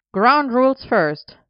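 loud, high, drawn-out demand; insisting over the group; insistent. A narrator's reading. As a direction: lay down the law, slowly and loudly. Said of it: "Elian insisted".

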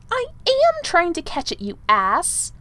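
high, loud angry snap; snapped. A narrator's reading. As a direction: snap back in outrage. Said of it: "snapped Merlin".

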